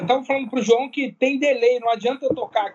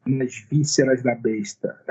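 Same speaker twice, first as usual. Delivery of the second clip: deep, slow cavernous voice; 11 semitones lower than usual. Retold or imitated. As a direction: intone it from deep in the chest. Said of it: "com aquela voz cavernosa".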